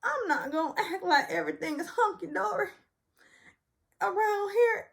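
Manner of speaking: high, indignant imitation; high mock-indignant Sutton. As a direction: declare it high and offended. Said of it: "So Sutton says".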